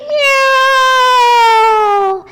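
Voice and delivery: long high meow like a spooky cat. Shown as a demonstration. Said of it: "spooky cat shape".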